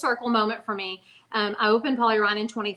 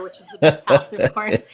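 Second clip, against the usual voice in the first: hammed-up declaration of house loyalty, a joke for the host; mock proud boast; 5 semitones lower, 8 dB louder than usual. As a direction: lower and much louder, like a playful bragging announcement. Mock boast about her own house.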